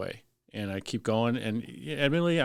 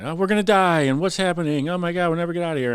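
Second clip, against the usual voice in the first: high, loud imitated panic; mock panic; 8 semitones higher, 6 dB louder than usual. Firefighter retelling how the bar workers panicked, mock screaming. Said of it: "they all start screaming and yelling".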